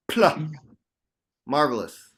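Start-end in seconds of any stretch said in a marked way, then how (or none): 0.1-0.4 bold syllable. Shown as a demonstration. Teacher leading the circle, loud confident syllable. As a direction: belt out the syllable, bright and loud. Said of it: "One syllable, any syllable".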